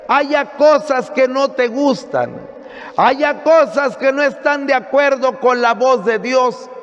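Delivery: emphatic sermon in long, insistent phrases; preaching. Acted. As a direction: drive it home to the congregation. Preaches to a congregation.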